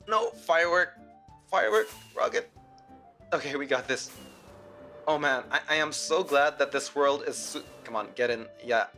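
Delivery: ordinary talk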